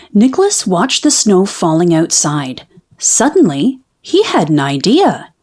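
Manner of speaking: usual speaking voice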